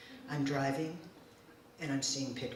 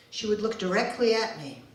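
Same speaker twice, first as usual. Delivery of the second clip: plain reading voice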